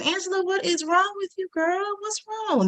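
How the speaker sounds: high, soft coaxing voice; trying to be empathetic. Retold or imitated. Friend to crying neighbour on the closet floor, trying to be empathetic. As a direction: attempt sympathy in a hushed, high voice. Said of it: "not a very empathetic person, but I'm trying to be".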